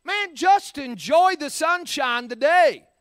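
a touch of upbeat nudge to a complainer; mock pep talk. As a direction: brush off the gloom with a bright push. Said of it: "And I thought".

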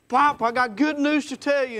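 breathless excitement calling to grandpa; excited child. Retold or imitated. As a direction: shout it out like a kid who can't wait. Boy running out with news.

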